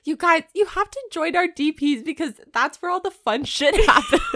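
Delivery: high, loud, laughing excitement. Real, not acted. Host plugs bonus episodes to listeners, laughing and high-pitched. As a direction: gush it loudly through laughter.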